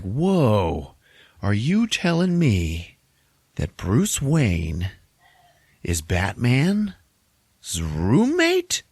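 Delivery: slow, stretched, leaping up at the end; hammed-up disbelief. Joker astonished by Sauron's news, slow hammed-up disbelief. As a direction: build slowly to a high, squeaked last word.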